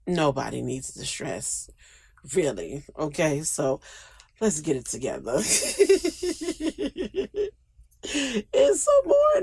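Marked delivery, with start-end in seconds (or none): 0.1-5.3 softer, lower winding down; soft low wrap-up; quiet, calmer. Real, not acted. 5.4-7.5 high laugh. Real, not acted.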